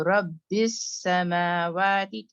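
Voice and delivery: slow रब्बिस समावाती with a held s; longer s. Shown as a demonstration. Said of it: "उससे थोड़ा ज्यादा हो".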